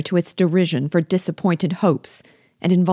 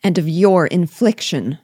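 raised, forceful accusation; energetic cry; 3 semitones higher, 6 dB louder than usual. A narrator's reading. Elizabeth hurling blame at Darcy, forceful cry. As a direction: throw the charge at him. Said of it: "cried Elizabeth with energy".

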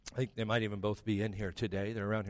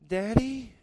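higher, sweet coaxing "Daddy?"; wheedling daughter; 10 semitones higher, 4 dB louder than usual. Retold or imitated. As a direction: coax like a child after a treat. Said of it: "And they'll go".

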